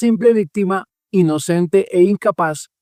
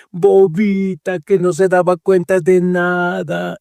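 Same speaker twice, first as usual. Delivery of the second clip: slow, mocking pity for a poor victim; sarcastic sympathy, said slowly; 2 semitones higher, much slower than usual. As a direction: drag it out like you are pretending to pity her. Mocking the pity she wants.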